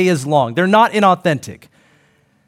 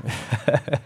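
short chuckle; 5 semitones lower, 8 dB quieter than usual. Real, not acted. Laughing at own joke.

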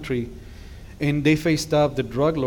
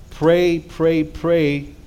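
louder, rising exhortation to pray; urgent call to pray; 2 semitones higher, 5 dB louder, much slower than usual. Real, not acted. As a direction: push the word pray out to the room. Preacher exhorting the congregation.

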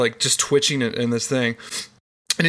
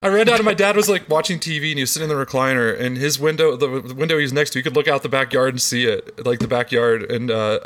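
normal talking voice

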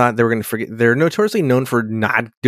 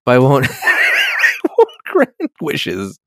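laughing; 3 semitones higher, much slower than usual. Retold or imitated. Riker refusing, laughing.

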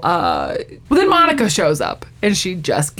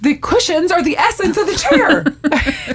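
high, indignant mock outburst; exasperated protest; 8 semitones higher than usual. Chandler protests to Joey over cushions, exasperated protest. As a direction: argue a silly point as if vital.

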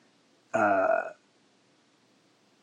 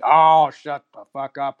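loud, slow, fed-up retort; imitating an irritated shout; retold angry snap; 3 semitones higher, 9 dB louder, slower than usual. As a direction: bark it out like a fed-up uncle. Uncle snapping in the truck.

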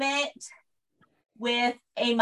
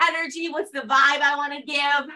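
ordinary talk

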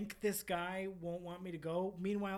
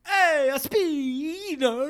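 put-on Italian accent; 9 semitones higher, 15 dB louder, much slower than usual. Italian chef voice again.

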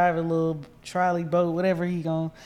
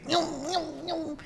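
high, soft engine zips; jet ski noise; 11 semitones higher, 7 dB quieter, much slower than usual. Retold or imitated. Friend retelling jet skis flying around, high mimicked buzzing. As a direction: imitate little engines zooming past, high and light.

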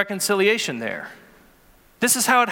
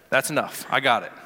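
much lower and softer, a firm parental warning; dad voice; 8 semitones lower, 4 dB quieter than usual. Retold or imitated. Ref turns to the complaining coach, low stern dad voice. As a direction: drop the pitch low and talk him down like a stern dad. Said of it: "I got my dad voice".

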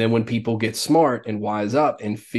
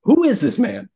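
high, louder mock disbelief; hammed-up baffled fan; mock bewildered onlooker; 7 semitones higher, 6 dB louder than usual. Scoffing at the coach's new voice, mock disbelief. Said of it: "You have never sound".

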